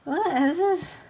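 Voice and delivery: loud, high-pitched baffled protest; exasperated outburst. Real, not acted. Ranting about silly spellings, exasperated outburst.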